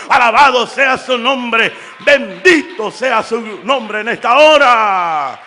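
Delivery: loud, full-force pulpit preaching; fiery preaching. Acted. Preacher urging the church about judgment day, loud fiery preaching. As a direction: preach it out to the back pews.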